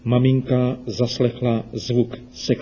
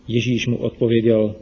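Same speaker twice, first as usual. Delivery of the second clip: plain reading voice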